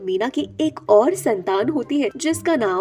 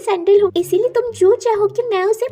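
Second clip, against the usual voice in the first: higher-pitched magical helper voice; high, put-on voice of a benevolent sandal; 7 semitones higher than usual. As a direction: announce yourself as an enchanted talking object.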